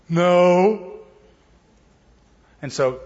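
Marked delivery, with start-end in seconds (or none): 0.1-1.0 disciples' answer. Retold or imitated.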